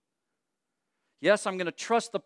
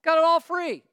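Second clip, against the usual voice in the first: whining Israelites; 9 semitones higher, 5 dB louder than usual. Retold or imitated. Preacher voicing Israelites to congregation, mock whining complaint. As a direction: whine like spoiled grumblers.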